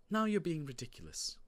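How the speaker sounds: scoffing parent, voice lifted; dismissive mother. Acted. Mother dismisses her son's tall tale.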